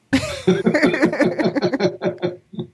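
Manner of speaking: high burst of real laughter. Real, not acted. Guest laughing at her ex-husband being described. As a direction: laugh out at the joke.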